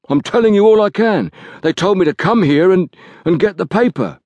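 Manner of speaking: frightened Liggett. Acted. Liggett voiced higher.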